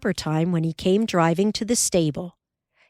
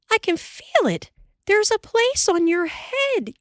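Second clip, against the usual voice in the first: much higher, amazed wife; wondering aloud in the dark; Ma astonished. Acted. Ma feeling the bare spot.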